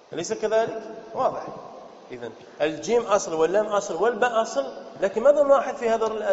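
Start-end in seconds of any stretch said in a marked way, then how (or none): none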